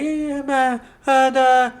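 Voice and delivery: high, slow mock babble; drawn-out, high-pitched broken syllables; imitated wordless fumbling. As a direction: imitate someone groping for foreign words. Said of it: "doesn't know how to, like, say what she's trying to say".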